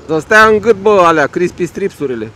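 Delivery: loud road-rage cursing, slowed down; real angry shout. Real, not acted. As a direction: yell an insult, loud and drawn out. Cursing another road user.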